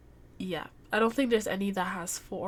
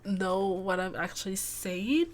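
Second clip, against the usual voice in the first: usual speaking voice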